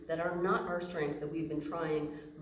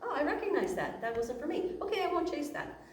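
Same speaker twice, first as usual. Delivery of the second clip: sudden realization; 10 semitones higher than usual. Retold or imitated. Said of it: "We just need to go".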